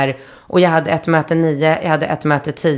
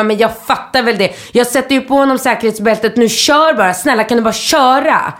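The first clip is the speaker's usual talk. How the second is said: higher, urgent, fed-up retort; exasperated pleading; 6 semitones higher than usual. Retold or imitated. Late passenger to taxi driver, exasperated plea. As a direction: snap back and beg him to get moving, pitched up.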